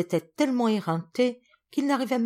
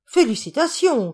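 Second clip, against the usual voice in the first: higher, louder chorus cheer; cheering cry; 7 semitones higher, 7 dB louder than usual. Acted. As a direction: shout congratulations together. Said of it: "crièrent les Vénusiennes en chœur".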